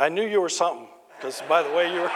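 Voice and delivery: chuckling through the punchline; amused retelling with laughter; laughing through the quote. Real, not acted.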